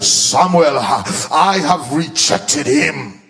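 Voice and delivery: booming low voice of God; voice of the Lord. Acted. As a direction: speak as God, grave and heavy. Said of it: "the voice of the Lord says".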